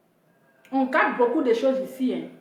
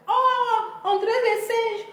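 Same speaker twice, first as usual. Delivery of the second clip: high, softer whiny jeer; high whiny mock jeer; mocking jeer, whimpering; 7 semitones higher, 3 dB quieter, slower than usual. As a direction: whine it up high like a mock cry. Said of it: "On dit".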